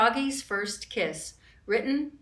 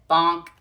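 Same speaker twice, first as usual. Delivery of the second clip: comic bonk; 3 semitones lower, 8 dB louder than usual. Acted. Frog falls on head.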